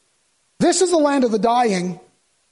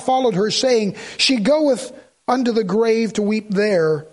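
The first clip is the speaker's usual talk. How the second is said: scripture reading; 4 semitones lower than usual. Acted. Reads scripture aloud.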